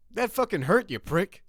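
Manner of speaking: high, loud furious yell; angry yell. Acted. Murph yelling at Seamus after being shot, furious. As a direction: hurl it at the man who shot you. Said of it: "he yells in the direction of Seamus".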